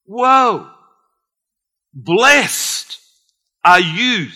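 high, loud joyful exclamation; exclaiming Jesus. Retold or imitated. Jesus praising Peter, high and loud. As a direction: burst out in delight. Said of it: "Jesus said to him".

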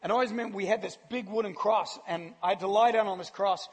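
ordinary delivery

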